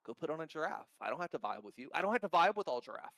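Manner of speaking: ordinary talk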